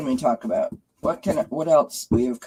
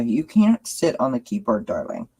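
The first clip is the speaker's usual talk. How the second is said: ordinary delivery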